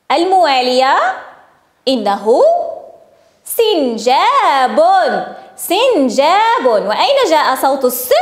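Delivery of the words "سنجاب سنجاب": very slow, syllable by syllable; slow sounding-out. Shown as a demonstration. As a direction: stretch the word so each sound stands out. Teacher stretches word for sound spotting.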